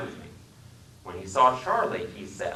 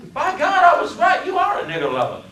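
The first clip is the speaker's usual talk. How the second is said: furious Southern shout; 10 dB louder than usual. Acted. Passenger explodes in rage.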